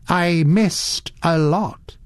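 wistful regret, slowly voiced; real regret. A narrator's reading. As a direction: let regret ring through, drawn out. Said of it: "His voice rang with a note of real regret".

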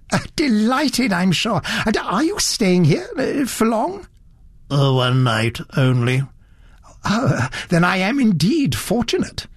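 a hint of a fussy elderly gent, curious; fussy gentleman. Satterthwaite pleased greeting.

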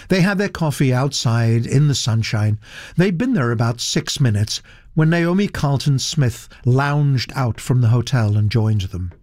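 usual speaking voice

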